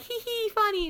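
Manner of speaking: very high, tittering imitation; mock giggling viewers. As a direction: titter like an easily amused fan.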